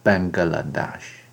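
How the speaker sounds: Bangladesh said very slowly and carefully. Shown as a demonstration. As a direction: spell out Bangladesh slowly, syllable by syllable. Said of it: "孟加拉国也可以读成".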